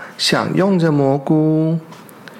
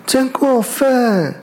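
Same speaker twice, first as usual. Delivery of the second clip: much higher, slow outraged cry; indignant shout; 8 semitones higher, slower than usual. Acted. Bear and weasel cry out at the fox, higher and slow. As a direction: drag out the outrage. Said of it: "他们两个喊着".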